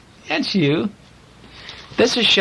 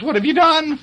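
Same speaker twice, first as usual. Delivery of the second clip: high-pitched imitation of an upset mama; scolding mama; 11 semitones higher than usual. Reader retells mama confronting the farmer, high mock shriek. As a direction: ask it high, as outraged mama. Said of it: "Mama comes out with the boy and says".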